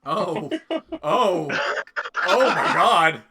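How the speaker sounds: rising astonished oh my God; shocked realisation. Real, not acted. GM reacts to spell reveal.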